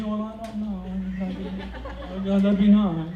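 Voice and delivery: mock sweet wife; imitating hesitant wife. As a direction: dither like a tempted bride. Wife hesitating over earrings.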